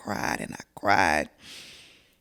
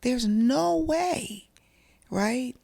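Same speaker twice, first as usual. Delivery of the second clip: disbelief; 8 semitones higher, much slower than usual. Real, not acted. Disbelief over son's arrest.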